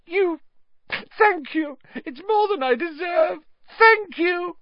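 very high, squeaky, halting thanks; grovelling high-pitched relief; gasping gratitude. A narrator's reading. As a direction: gush thanks in a high coward's voice. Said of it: "gasped Pettigrew".

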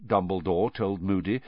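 ordinary delivery

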